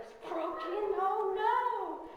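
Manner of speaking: high, loud alarmed cry; shocked dismay. Retold or imitated. Girl learning her hand is broken, high and alarmed. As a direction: gasp it out in alarm.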